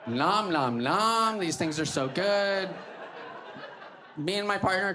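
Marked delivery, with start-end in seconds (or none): 0.0-1.4 playful nom nom, rising; mock munching. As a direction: fake happy munching sounds. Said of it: "eating sounds".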